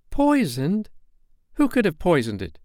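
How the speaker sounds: high, startled questioning; incredulous boy. Acted. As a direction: question it in disbelief, pitch leaping up. Peter disbelieves the fairy's warning.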